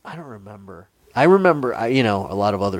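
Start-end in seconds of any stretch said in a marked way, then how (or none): 0.0-0.9 whisper. Real, not acted. Whispered reply about daddy.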